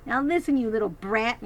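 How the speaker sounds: put-on bully snapping at a child; snapped. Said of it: "he snapped at my sister".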